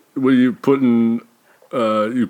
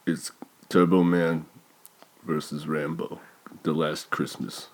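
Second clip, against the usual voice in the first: much lower and softer mumble; 6 semitones lower, 9 dB quieter than usual. Acted. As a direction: mumble it low and slow. Low mumbled pitch.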